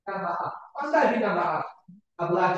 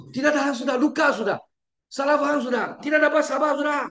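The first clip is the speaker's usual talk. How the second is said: rising higher, ending in a fast shout; building outburst; 7 semitones higher, faster than usual. Retold or imitated. Preacher voicing a wife who cannot take more, building to a shout. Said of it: "Dia kata".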